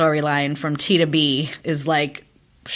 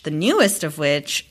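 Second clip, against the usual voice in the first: usual speaking voice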